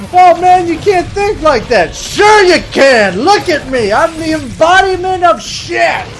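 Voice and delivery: high, comic shouted rant; exasperated yelling. Acted. Arguing down positive thinking, hammed-up exasperated shouting. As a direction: yell it in high-pitched outrage.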